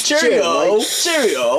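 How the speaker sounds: high, loud, hammed-up mock British; god-awful British accent. Showing friends how he teased the gym kid, awful British accent. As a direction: call it out in a badly faked British accent. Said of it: "that's a god-awful accent".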